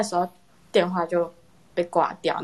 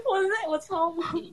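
stunned; 11 semitones higher, 6 dB quieter, slower than usual. Real, not acted.

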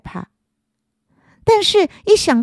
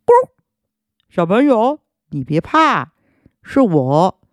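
hoarse voice. Acted. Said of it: "一阵沙哑的声音".

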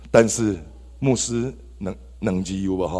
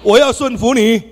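much higher and louder, a rallying cry; loud vow; 14 semitones higher, 10 dB louder than usual. Shown as a demonstration. Urging the congregation to shout along, loud rallying vow. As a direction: shout it so the hall echoes it back. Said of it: "来，大声讲啊".

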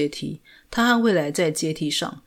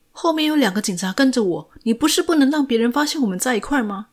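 urgent, fast and higher, anxious whisper-shout feel avoided; nervous; 6 semitones higher, faster than usual. A narrator's reading. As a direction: rush it out nervously, pitch up. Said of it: "陈念紧张地说". (紧张急促地说)

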